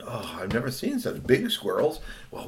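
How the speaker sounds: plain reading voice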